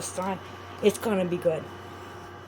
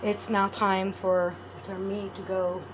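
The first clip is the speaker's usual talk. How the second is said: ordinary delivery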